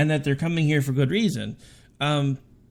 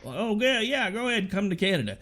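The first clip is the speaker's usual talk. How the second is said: higher, breezy mock-welcoming voice; mocking prime minister; 6 semitones higher than usual. Podcast host mocking Canada's prime minister's welcome. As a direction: airily mimic the leader's open invitation.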